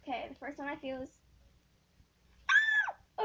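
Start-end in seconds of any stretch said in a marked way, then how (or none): 2.5-3.2 sudden high yelp of surprise; startled shriek. Real, not acted. Girl unboxing squishies, startled by touch, shrieks. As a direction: jump and shriek at the feel of it.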